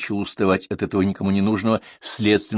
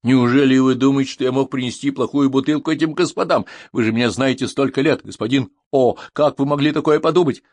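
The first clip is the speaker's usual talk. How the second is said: higher, louder, offended appeal; indignant protest; 5 semitones higher, 5 dB louder than usual. A narrator's reading. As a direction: plead injured honour. Accused waiter protests his honour. (возмущённо, с обидой)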